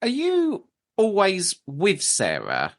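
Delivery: slow, high-starting question, faintly creepy; a little creepy. Real, not acted. Host asks spirit about the medium, a little creepy. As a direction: draw the question out slowly, slightly eerie. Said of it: "Sounded a little creepy the way".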